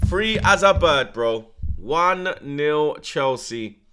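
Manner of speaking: slow, loud, dragged-out disbelief; stunned. Real, not acted. Fan repeats the goal slowly to himself, stunned and loud. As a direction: stretch every word in shock.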